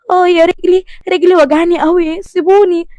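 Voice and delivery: high aching protest; pained wail.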